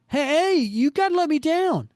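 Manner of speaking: high, loud desperate call; panicked yell. Acted. Joe Face calling after departing Spider-Man, high panicked yell. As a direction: shout after him to be let down.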